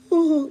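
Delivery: high, loud mock wail of "ooh"; mock sob ooh. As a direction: let out a hammed-up crying ooh. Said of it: "sit down and be like".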